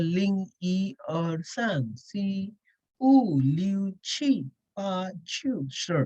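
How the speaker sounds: numbers one to ten in Mandarin, said slowly one by one; counting in Chinese. Shown as a demonstration. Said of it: "Say, for example".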